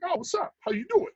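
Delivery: high, easygoing retold hello; sincere friendly greeting. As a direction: greet with no edge at all. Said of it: "he meant it really".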